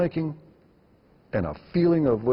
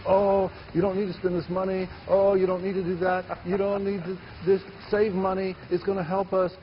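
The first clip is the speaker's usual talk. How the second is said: imitating complaining producers in a higher pitch; mock a nagging producer, pitched up; 8 semitones higher than usual. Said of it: "producers will always complain and say".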